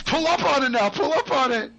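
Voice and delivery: high-pitched excited instruction; urgent coaching. Real, not acted. Coaching the pilot to pull up, urgent high call. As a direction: urge them on in a high voice. Coaching a player through the flight.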